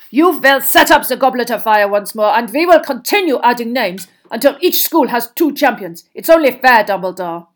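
indignant headmaster laying down demands; angry Karkaroff figure, demanding. Acted. Rival headmaster demanding two champions per school, angrily.

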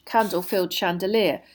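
ordinary delivery

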